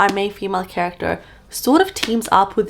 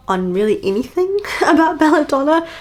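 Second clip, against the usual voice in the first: laughing mid-line, a bit higher; sheepish laugh; 8 semitones higher, 3 dB louder than usual. Real, not acted. Laughing as she admits to viewers she forgot the update. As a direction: giggle through the admission.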